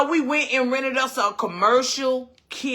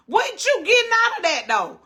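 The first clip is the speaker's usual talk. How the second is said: pitched way up on What you getting out of that; high incredulous challenge; 7 semitones higher than usual. Real, not acted. To the critic online, high incredulous demand. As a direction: push the question up high in disbelief.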